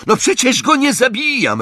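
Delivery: high, exasperated outburst; roared retort. A narrator's reading. Crape snapping back at Malfoy, roared retort. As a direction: throw it back at him, pitched high. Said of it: "ryknął Crape".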